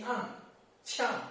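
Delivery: short drop on "an" and "qiang"; fourth tone. Shown as a demonstration. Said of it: "fourth tone".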